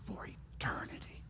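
breathy whispered echo of "For eternity". Acted. Preacher echoing his promise to the congregation, whispered. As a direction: drop to a hushed whisper on "eternity". Preacher repeats closing phrase.

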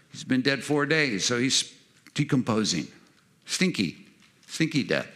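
ordinary talk, nothing marked

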